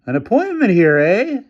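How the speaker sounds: doubt every word he says; skeptical officer. A narrator's reading. Officer doubting the developer's story, high and suspicious. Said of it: "answered one of the officers".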